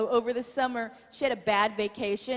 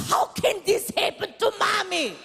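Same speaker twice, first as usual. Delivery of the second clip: much higher, wailing mock mother; whiny mom impression; 7 semitones higher than usual. Mom complaining after falling off the camel, whiny mock wail. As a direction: whine like a spoiled mother. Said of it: "She was like".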